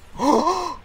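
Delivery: loud, high-pitched gasp; shocked gasp. Real, not acted. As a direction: suck in air sharply in shock. Shock at the striker card.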